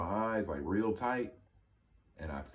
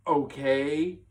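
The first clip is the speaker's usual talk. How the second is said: higher, louder okay with a questioning rise; wary okay; 8 semitones higher, 8 dB louder than usual. Retold or imitated. Man answering the kid about fetching his mom, wary. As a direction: act out a baffled, doubtful agreement.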